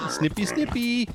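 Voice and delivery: high, sweet snippy snippy; singsong baby talk. Pretend. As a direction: chirp it at the sheep. Singsong while shearing.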